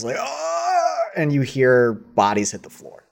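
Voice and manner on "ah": high-pitched held cry of pain; acted yell. Game master voicing two victims cut down, acted high yell. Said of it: "two yells".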